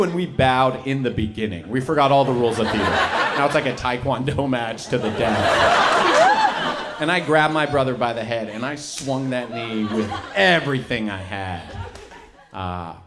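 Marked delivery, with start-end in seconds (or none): none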